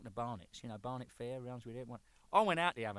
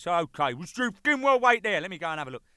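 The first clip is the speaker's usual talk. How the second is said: impatient boss; 5 semitones higher, 11 dB louder than usual. Retold or imitated. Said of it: "So Elrod goes".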